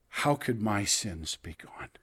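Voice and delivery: whispered, as if afraid to ask aloud; a hushed private question; doubting whisper. Pretend. A doubting listener wondering to himself, whispered.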